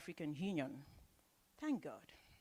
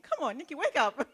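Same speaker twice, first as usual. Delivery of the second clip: higher, louder self-scolding; scolding herself; 8 semitones higher, 7 dB louder than usual. Real, not acted. MC catching her own slip, playfully scolding herself. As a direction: tell yourself off with a laugh. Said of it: "I keep saying morning".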